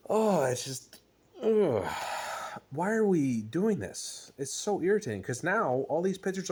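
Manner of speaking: loud, exasperated groan then question; exasperated rant. Real, not acted. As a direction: vent your frustration. Frustrated rant about bullpen.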